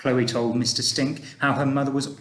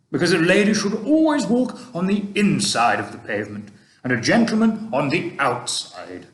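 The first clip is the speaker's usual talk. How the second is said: put-on a kindly old gentleman, louder and gently advising; Mister Stink; 5 semitones higher, 5 dB louder than usual. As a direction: speak like an old tramp giving fatherly advice. Mister Stink explaining manners.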